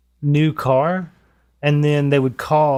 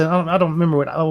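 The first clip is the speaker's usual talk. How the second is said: normal talking voice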